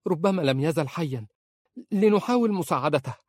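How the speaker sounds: high, urgent accusing plea; frantic pleading. Acted. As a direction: beg for help, then turn on him. She pleads and accuses husband. (توسل محموم واتهام)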